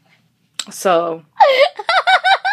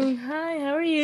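higher, softer put-on cashier greeting; a light, sugary service voice; fake customer voice; 6 semitones higher, 8 dB quieter than usual. Cashier greeting shoppers at the register, fake cheerful voice. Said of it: "You put that fake voice on".

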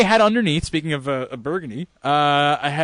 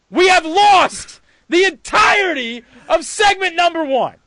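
mock dramatic; 11 semitones higher, slower than usual.

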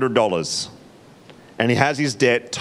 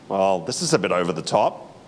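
understated sniffy remark; disapproving aside; 8 semitones lower, 3 dB quieter than usual. Retold or imitated. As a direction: lower and softer, a touch prim. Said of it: "I'd be like".

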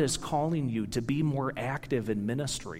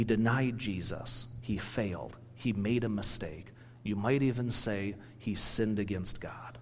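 almost whispered, soft and low; hushed; 5 semitones lower, 7 dB quieter than usual. Real, not acted. Preacher tells the congregation Peter sinned, hushed whisper. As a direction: let it drop to a near whisper, as if confessing. Sermon reaches Peter's failure.

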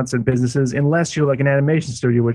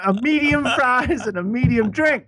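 mock customer order; 11 semitones higher than usual. Acting out ordering at McDonald's.

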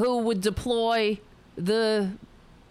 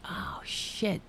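whispered curse; 5 dB quieter than usual. Real, not acted. Setup failed, muttered to self.

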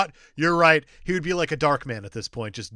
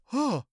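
manga grunt; 11 semitones higher than usual. Retold or imitated. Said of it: "the weird little noises".

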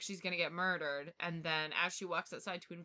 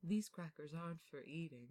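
spooky butler; 7 dB quieter than usual. Acted. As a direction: hush it like a eerie butler showing his house. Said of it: "sounds like a spooky butler".

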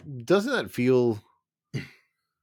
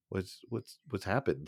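hushed puzzled voice; soft and quiet; 6 dB quieter than usual. Retold or imitated. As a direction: say it very softly. Cat mourning, soft voicing.

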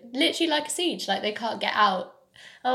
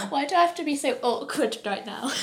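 normal talking voice

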